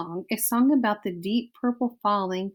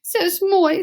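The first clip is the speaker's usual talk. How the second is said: high, louder French wail; dramatic French mother; 9 semitones higher, 5 dB louder than usual. Acted. Antoinette blamed by her husband, high French lament. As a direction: cry it out in French like a diva. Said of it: "said Antoinette".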